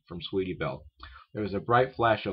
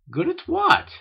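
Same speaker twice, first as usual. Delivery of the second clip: very high, loud question; taken aback; 9 dB louder, slower than usual. A narrator's reading. Sister startled by the filly's studies, high surprised question. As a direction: shoot the question up in astonishment.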